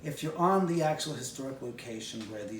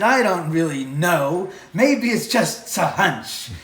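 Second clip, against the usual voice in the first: slow, loud, mock-innocent drawl; sarcastic; 4 semitones higher, 11 dB louder, slower than usual. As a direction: play dumb, slowly and loudly. Sarcastic reply to the actor.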